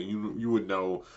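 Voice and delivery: normal talking voice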